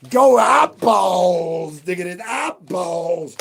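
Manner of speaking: loud, high, slow shouted hype. Acted. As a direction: belt it out slowly with a put-on voice. Hyped shout at viewers.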